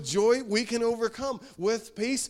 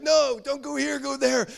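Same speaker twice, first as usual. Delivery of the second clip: parent yelling at learner driver; 9 semitones higher, 5 dB louder than usual. Retold or imitated. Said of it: "and you're like".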